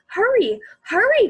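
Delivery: urgent shouted hurry; urgent cry. Acted. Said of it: "cried Jessie".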